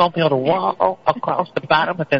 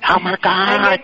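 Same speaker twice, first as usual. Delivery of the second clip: usual speaking voice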